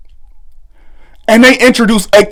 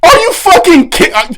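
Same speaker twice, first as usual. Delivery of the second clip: ordinary delivery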